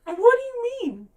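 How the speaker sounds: loud, high-pitched disbelief; incredulous outburst. Real, not acted. Podcaster ranting to co-hosts about Tamlin's reaction, incredulous outburst. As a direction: shout it, voice leaping way up in playful outrage. Mock outrage at character's reaction.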